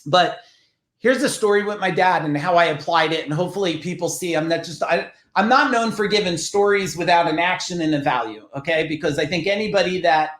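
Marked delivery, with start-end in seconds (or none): none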